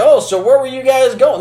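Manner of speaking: a hint of the sheriff's sudden chattiness; sheriff making conversation. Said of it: "all of a sudden he's like".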